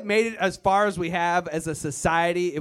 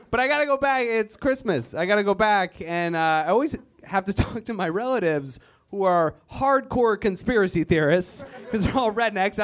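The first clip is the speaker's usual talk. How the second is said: usual speaking voice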